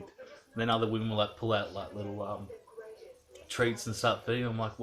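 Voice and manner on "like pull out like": ordinary talk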